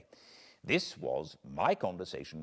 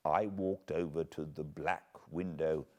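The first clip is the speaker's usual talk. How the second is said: ordinary delivery, nothing marked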